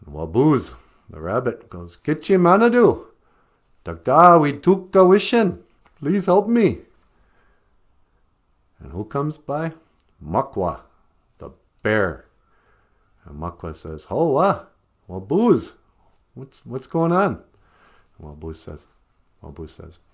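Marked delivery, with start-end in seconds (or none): 2.0-6.8 high, loud pleading prayer of a trapped rabbit; rabbit praying. Acted. Trapped rabbit begging the Great Spirit, high pleading call. 14.1-17.4 higher, louder bear's hearty hello; a hint of a jovial bear calling out; friendly bear. Bear stumbling on the trapped rabbit, hearty greeting.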